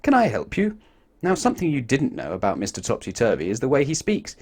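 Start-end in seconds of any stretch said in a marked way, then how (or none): 0.0-0.8 polite manager. A narrator's reading. Hotel manager greeting Mister Topsy-Turvy, polite and high. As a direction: greet the guest brightly. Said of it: "he said".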